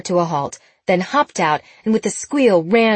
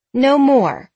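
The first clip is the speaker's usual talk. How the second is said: louder, higher motherly warning; firm mommy voice; 7 semitones higher, 6 dB louder than usual. A narrator's reading. Woman refusing him another brownie, firm mommy voice. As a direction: put your foot down like a mother. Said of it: "she said in her firm mommy voice".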